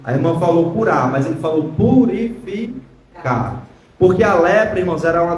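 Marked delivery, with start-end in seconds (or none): 1.8-3.6 "purificar" drawn out slowly, syllable by syllable; stress on PURIFICAR. Shown as a demonstration. Preacher pointing congregation to an interesting word, "purificar" slowly stressed. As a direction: stretch out "purificar" slowly for emphasis. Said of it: "Olha que palavra interessante".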